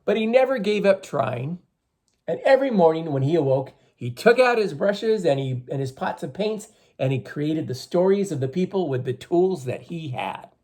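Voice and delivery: slow, expressive storyteller voice; story reading for children. A narrator's reading. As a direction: read the legend to the class, drawn out. Teacher reading legend to children.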